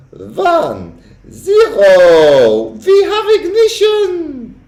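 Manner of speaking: high, swelling witch voice counting to zero; witch countdown. A narrator's reading. Witch counting the boy down to his doom, rising gleefully. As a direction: build it to a gleeful climax.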